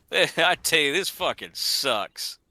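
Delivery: slow, stretched sulking grumble; slow hammed-up complaint; mock sulk. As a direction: drag it out like a sad sack. Copying friend's line slowly.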